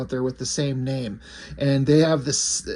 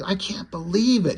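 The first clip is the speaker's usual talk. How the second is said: ordinary delivery, nothing marked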